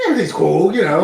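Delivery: high, chirpy fake calm; mock breezy reassurance; fake cheerful. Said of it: "It's the Irish accent".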